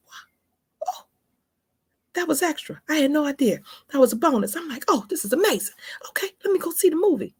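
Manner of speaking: high breathless excited whisper; squealing under the breath; thrilled whisper. Retold or imitated. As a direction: gasp it out in delighted disbelief. Retold thrilled inner reaction.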